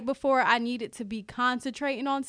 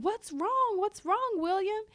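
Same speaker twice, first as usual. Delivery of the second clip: imitating concerned friends; 5 semitones higher, 4 dB quieter, slower than usual. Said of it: "the girls are asking".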